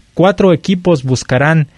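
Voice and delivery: ordinary talk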